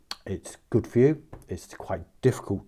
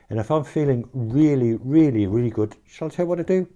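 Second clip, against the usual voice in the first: each really louder and higher, rushing into the question; building excitement; 5 semitones higher, 4 dB louder than usual. Real, not acted. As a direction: pile up the reallys toward a teasing reveal. Building excitement over repeated really.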